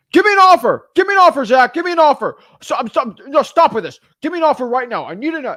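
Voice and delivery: loud, high, pushy demand; hammed-up aggressive seller yelling at the wholesaler. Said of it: "really dominating personalities".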